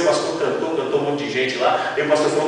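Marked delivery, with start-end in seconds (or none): none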